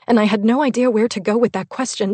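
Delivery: usual speaking voice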